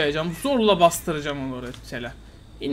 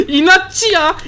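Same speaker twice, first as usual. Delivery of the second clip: mocking laughter; 8 dB louder, much slower than usual. Real, not acted. Laughs at the stubborn player.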